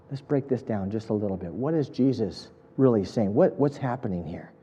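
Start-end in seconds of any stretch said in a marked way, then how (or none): none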